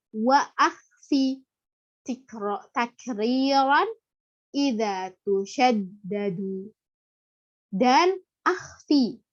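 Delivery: slow chanted Arabic verse; Quran-style recitation. Shown as a demonstration. (melantunkan bait dengan lambat)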